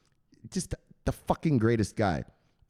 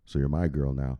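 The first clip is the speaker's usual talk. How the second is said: much lower, smooth seductive ask; 11 semitones lower than usual.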